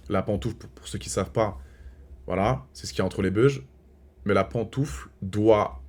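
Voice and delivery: a soft, low confiding aside to viewers; hushed aside. Real, not acted. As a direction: lean in and tell them the secret quietly. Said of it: "quiet confiding to camera". (confidence chuchotée aux abonnés)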